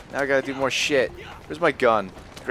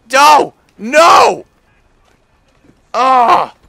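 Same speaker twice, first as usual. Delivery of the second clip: frustrated screams; 8 semitones higher, 11 dB louder, much slower than usual. Real, not acted. Failing the game mission.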